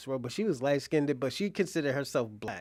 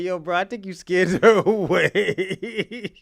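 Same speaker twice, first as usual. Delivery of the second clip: slow, louder, laughing through it; laughing while teasing; laughing quote; 4 semitones higher, 10 dB louder, slower than usual. Real, not acted. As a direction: giggle the line to the guy.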